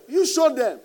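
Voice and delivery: loud, high, punched command; emphatic shout. Real, not acted. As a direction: drive it home with force. Emphatic preaching line.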